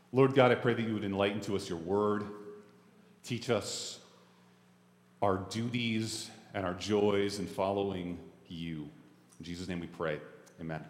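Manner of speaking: low, soft praying voice; prayer. Real, not acted. Pastor asking God to enlighten the word, hushed prayer. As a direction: pray quietly, pitched well below preaching.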